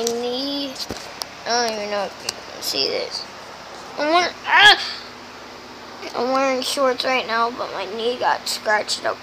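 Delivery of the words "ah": pained yelp. Real, not acted. Sudden pain while showing knee.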